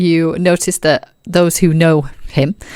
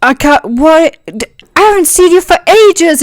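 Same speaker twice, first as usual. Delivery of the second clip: higher, louder, delighted; excited Ro; 11 semitones higher, 4 dB louder than usual. Acted. Ro greets Aragorn after ages apart, thrilled. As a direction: gush at a long-lost friend.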